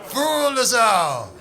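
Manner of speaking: laughing preacher's shout; mock preacher shout. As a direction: proclaim it like a hammed-up preacher. Mock sermon about Luke as Jesus.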